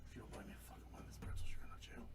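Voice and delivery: low whispered threat; whispering. Pretend. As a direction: hiss it into their ear. Pretend threat whispered behind someone.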